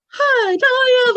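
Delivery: high, loud, drawn-out mock hello; over-the-top falsetto greeting; excited group greeting. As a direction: squeal it out slowly like a delighted crowd. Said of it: "everybody's all".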